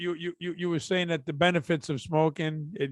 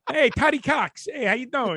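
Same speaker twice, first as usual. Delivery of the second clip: mocking greeting; 6 semitones higher than usual. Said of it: "mock greeting as viral nickname".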